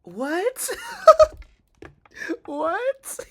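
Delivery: loud, very high shriek of disbelief; incredulous laughter. Real, not acted. Streamer reacting to terrible acting, incredulous and laughing. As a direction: burst out with a stunned high yelp and laugh. Baffled by the clip just played.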